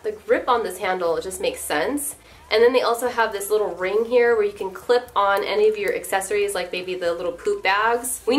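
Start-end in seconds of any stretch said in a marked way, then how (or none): none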